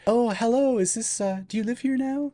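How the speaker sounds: high, soft, tentative hello; rehearsed casual greeting. Retold or imitated. Visitor rehearsing lines for the new homeowner, tentative greeting. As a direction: feign a chance meeting, softly and high. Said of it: "I was practicing lines while I was driving".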